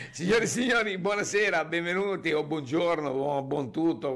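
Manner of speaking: high presenter's welcome, settling down gradually; show opening. Pretend. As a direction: launch the show like a TV host. Host launches the show intro. (apertura da presentatore televisivo)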